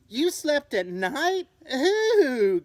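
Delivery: high, loud, slow disgusted gasp. Acted. Shocked bat squealing at a night sleeper, disgusted. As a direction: squeal it out in disbelief. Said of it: "gasped another".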